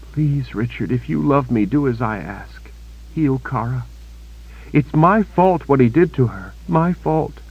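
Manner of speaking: dying Kaelin begging Richard to heal Kara; pleading young woman. A narrator's reading. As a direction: beg him, blaming herself. Kaelin begs him to heal Kara.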